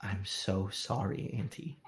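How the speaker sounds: low, soft and slow, hesitant; timid apology. Acted. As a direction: say sorry softly and slowly, ashamed. Nephew apologizes to aunt.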